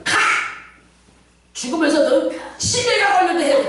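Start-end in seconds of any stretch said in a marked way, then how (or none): 0.0-0.1 one explosive yell; shouted banishing command. Shown as a demonstration. Preacher shows ordering death's messenger away, explosive shout. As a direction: yell it to repel the attacker.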